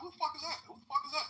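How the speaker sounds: high, mock outraged boyfriend; annoyed boyfriend. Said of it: "you're like".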